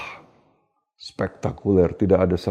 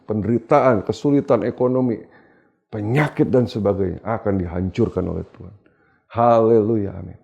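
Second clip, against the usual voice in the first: usual speaking voice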